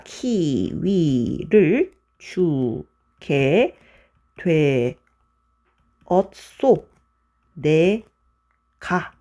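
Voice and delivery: very slow, syllable by syllable; drawn-out recitation. Shown as a demonstration. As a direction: stretch each syllable with long pauses, as if writing along. 표를 그리며 암기 구절을 읊음. (한 음절씩 길게 늘여 천천히)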